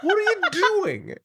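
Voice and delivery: high, smug mocking tone turning into laughing disbelief; mock the tweeter, then laugh at them. Friend mocks a clueless tweeter, high and smug. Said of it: "I'm like".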